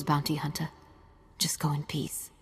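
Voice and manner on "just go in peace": weary whisper. Acted. Sister pleads with hunter.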